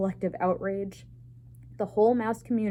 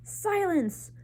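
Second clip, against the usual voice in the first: high roaring command; head mouse silencing the assembly, roaring; roared; 11 semitones higher than usual. A narrator's reading. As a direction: roar it down at the crowd. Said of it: "roared the most very honored head mouse".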